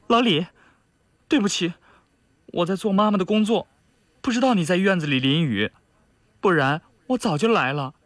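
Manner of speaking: put-on eager young sweetheart explaining herself; young woman, apologetic. A narrator's reading. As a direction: apologise sweetly to the man you love. Character dialogue in narration.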